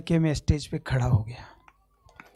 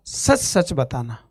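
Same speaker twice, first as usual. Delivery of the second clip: lower, softer and slow, cooled down; calm doctor; 6 semitones lower, 5 dB quieter, slower than usual. Retold or imitated. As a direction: ask gently like a calmed doctor. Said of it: "एकदम ठंडा". (शांत, धीमी आवाज़ में पूछता doctor)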